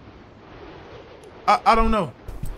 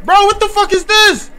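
shocked yelling; 13 semitones higher than usual. Real, not acted. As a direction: yell in total disbelief. Shocked by horror game scare.

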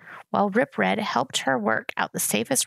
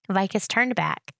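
ordinary delivery

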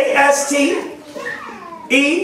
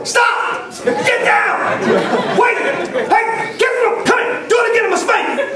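much higher mock yelling at kids; mock parent yelling; 8 semitones higher than usual. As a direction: play a parent barking at kids from the phone. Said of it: "you be on the phone like".

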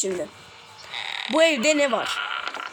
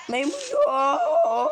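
drawn-out mock cry, higher and very slow; mock wail; 6 semitones higher, much slower than usual. Gamer mourning his lost monkey, in a drawn-out mock cry. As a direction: wail it like a heartbroken child, hammed up.

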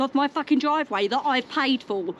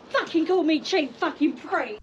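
ordinary delivery

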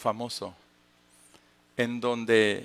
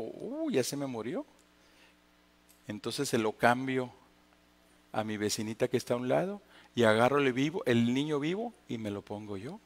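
much softer, lower, sneaky aside; hushed scheming mother; 4 semitones lower, 7 dB quieter than usual. Acted. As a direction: murmur the plan to yourself. Mother voiced in Solomon story. (madre murmurando su plan en voz baja)

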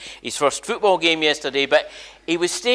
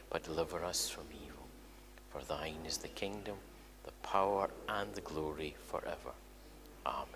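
the Lord's Prayer, mostly whispered and much softer; hushed prayer; 10 semitones lower, 17 dB quieter than usual. Real, not acted. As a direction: pray it quietly, almost under the breath.

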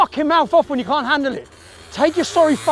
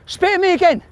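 goading him, pitched up and forceful; real confrontational anger; angry challenge; 8 semitones higher than usual. Real, not acted. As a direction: dare him, angrily, over and over. Daring a man who spat.

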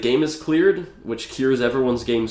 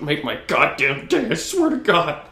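higher, hammed-up outburst; mock desperate dare; 7 semitones higher, 3 dB louder than usual. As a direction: build to an overwrought mock dare. Acted dare to be shot.

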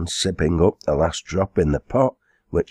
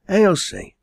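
high, falling call of a name. Acted. Landlady to the servant girl, calling out.

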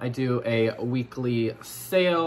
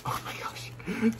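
slow, breathless whisper; awed whisper; 9 semitones higher, 7 dB quieter than usual. Real, not acted. Vlogger whispering in awe at the babies. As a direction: whisper in delight.

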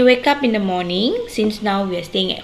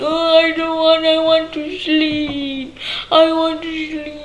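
whiny, much higher child voice, mock crying; hammed-up cranky kid refusing to wake; 6 semitones higher than usual. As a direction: whine like a sleepy kid who won't get up. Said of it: "cranky, crying".

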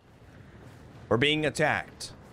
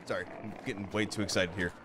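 low, soft and quick; subdued apology; 10 semitones lower, 8 dB quieter, much faster than usual. Real, not acted. Gamer apologizing for his outburst, quiet hurried mumble. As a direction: drop right down and hurry the apology.

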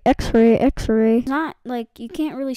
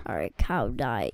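much lower villain voice; deep menacing voice; 12 semitones lower, 3 dB quieter than usual. Acted. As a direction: growl it at the cow. Deep voice threatening cow.

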